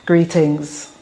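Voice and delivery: ordinary talk, nothing marked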